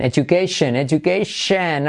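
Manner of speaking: "education" ending "shen", slowing down; correct shen ending. Shown as a demonstration. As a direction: stretch "education" to end in shen.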